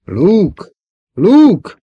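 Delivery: high, loud call from downstairs; shouting. A narrator's reading. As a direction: holler the name up to someone out of sight. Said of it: "Kiáltás harsant odalentről". (hangos kiáltás lentről)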